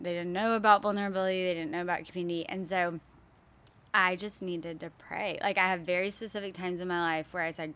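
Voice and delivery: usual speaking voice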